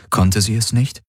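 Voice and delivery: plain reading voice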